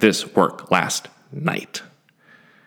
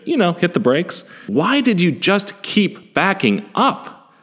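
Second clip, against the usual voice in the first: exasperated self-scolding; 8 semitones higher than usual. Retold or imitated. Speaker scolding himself for not stopping the car, high and exasperated. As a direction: berate yourself in disbelief, pitched up. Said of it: "And I ask myself".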